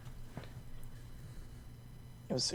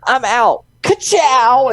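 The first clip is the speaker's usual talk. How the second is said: higher, louder catchphrase shout; mock Lightning McQueen exit; 10 semitones higher, 5 dB louder, slower than usual. Friend retells Mobius quitting on Renslayer, loud cocky ka-chow. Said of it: "he's just like".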